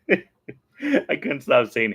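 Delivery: slow words breaking into real laughter; can barely get it out for giggling; laughing while talking. Real, not acted. Recalling the hey bub joke, slow and laughing.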